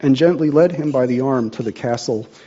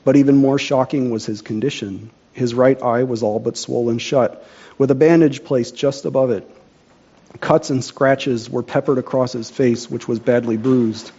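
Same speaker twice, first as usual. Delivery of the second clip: ordinary delivery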